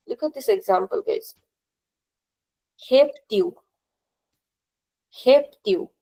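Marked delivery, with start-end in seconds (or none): 2.9-3.5 "Heptiu" with the first syllable, Hep, stressed and extended. Shown as a demonstration. Teacher says "Heptiu" so students hear the stress, Hep stressed. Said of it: "Which part seems extended to you?". 5.2-5.9 "Heptiu" with the first syllable, Hep, stressed and extended. Shown as a demonstration. Teacher says "Heptiu" so students hear the stress, Hep stressed. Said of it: "Which part seems extended to you?".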